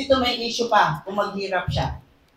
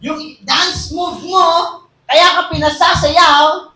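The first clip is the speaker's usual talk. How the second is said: louder and higher, building to a shout; preaching at full force; 6 semitones higher, 6 dB louder than usual. Acted. Preacher correcting the church about tithes, shouting. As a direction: hammer the point home to the crowd.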